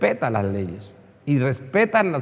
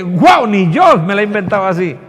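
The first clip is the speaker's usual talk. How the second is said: loud, high mock amazement; astonished devil; 4 semitones higher, 9 dB louder than usual. Devil impressed by an over-elaborate prayer, mock astonishment. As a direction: play the devil hugely impressed, voice raised. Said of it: "Hasta el mismo diablo dice".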